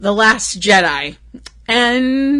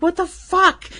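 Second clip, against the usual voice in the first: a high, incredulous 'what the fuck' in her guy's voice; boyfriend's disbelief; 8 semitones higher than usual. Retold or imitated. She mimics her boyfriend reacting to her animal worries, high and incredulous. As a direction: imitate his baffled outburst, pitched way up. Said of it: "he's like".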